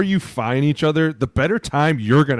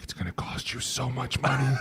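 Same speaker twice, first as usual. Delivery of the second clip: muttered warning to himself; 11 dB quieter than usual. Retold or imitated. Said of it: "I would be like".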